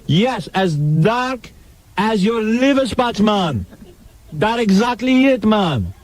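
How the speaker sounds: bad Jamaican accent. Mock witness confirms a detail to the interviewer, bad Jamaican accent. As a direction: stretch out the fake Jamaican vowels. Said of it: "Worst Jamaican accent I ever heard".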